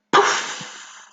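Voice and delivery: soft breathy poof; puffed sound effect. Shown as a demonstration. Reading the comic's sound effect, a soft puffed poof. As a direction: puff it out like magic smoke. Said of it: "this sound effect here that says".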